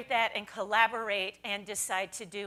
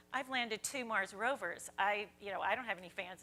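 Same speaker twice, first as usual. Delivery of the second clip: leaning in, quiet; 4 semitones lower, 10 dB quieter than usual. Retold or imitated. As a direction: mutter it close to her ear like a confidence. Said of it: "he leans over and he goes".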